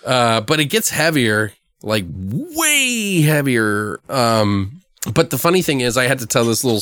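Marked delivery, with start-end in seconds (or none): 2.1-4.0 WAY stretched very long; drawn-out emphasis. Real, not acted. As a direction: hold the word "way" at length for emphasis.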